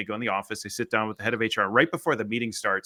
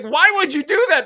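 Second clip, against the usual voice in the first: very high, loud mock outrage; exasperated yell, pitched way up; going off on her; 10 dB louder than usual. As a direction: imitate someone losing his mind. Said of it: "he just went off on her".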